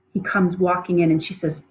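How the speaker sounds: normal talking voice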